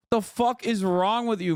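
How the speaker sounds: ordinary talk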